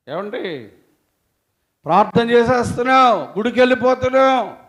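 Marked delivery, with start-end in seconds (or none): 1.8-4.6 loud, high sneering imitation of the scoffers; mocking quote. Preacher mocks scoffers' taunts to congregation, loud sneering. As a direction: mimic the taunt of scoffing husbands. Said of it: "అని హేళన మాటలు".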